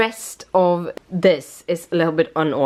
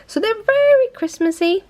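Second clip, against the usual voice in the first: very Christmassy sung out much higher; excited gush. Real, not acted. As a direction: squeal it with delight over the nails. Gushing about her Christmas nails.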